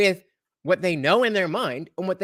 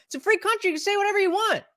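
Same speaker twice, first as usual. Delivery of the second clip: put-on sing-song kid quote; much faster than usual. Quoting childhood saying.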